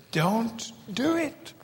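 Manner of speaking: soft, slow, high plea; pleading don't. Real, not acted. Preacher begging the congregation softly, slow and pleading. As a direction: beg them gently not to.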